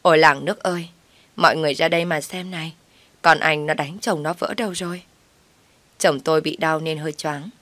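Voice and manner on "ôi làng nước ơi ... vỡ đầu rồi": plain reading voice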